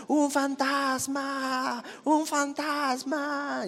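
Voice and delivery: high, slow, drawn-out scared wail; frightened cry. Acted. Disciples in the boat crying out at a ghost, high slow frightened wail. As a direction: wail it out as if terrified. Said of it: "y gritaron".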